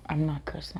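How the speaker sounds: whispered, sheepish aside. Real, not acted. Vlogger excusing her swearing to viewers, whispered. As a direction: confess it under your breath. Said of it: "whispered aside to viewers".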